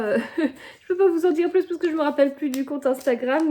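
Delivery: laughing through the words at her own forgetfulness; amused at herself, laughing as she speaks; laughing while talking. Real, not acted. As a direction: say it with a real laugh in the voice.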